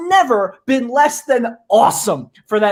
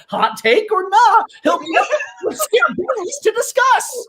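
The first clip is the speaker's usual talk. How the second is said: high, hammed-up trailer voice; a hammed-up promo voice; mock announcer; 12 semitones higher than usual.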